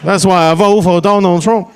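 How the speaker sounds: gleeful mock Chinese accent voicing a crowd. Comic voicing Chinese people, gleeful in mock Chinese accent. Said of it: "Chinese people are like".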